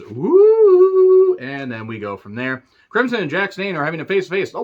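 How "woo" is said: high, loud excited woo cheer. Real, not acted. Reviewer cheering a promo comeback, loud high woo. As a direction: let out a big wrestling-style woo.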